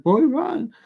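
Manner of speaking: high, urgent mock shout of a nurse. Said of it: "and she was like".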